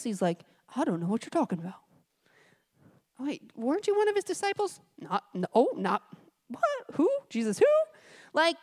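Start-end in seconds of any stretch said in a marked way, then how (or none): 0.7-1.8 hurried mock denial. Peter's denial voiced. 3.2-4.8 high questioning accuser. Retold or imitated. 5.0-7.7 flustered mock denial.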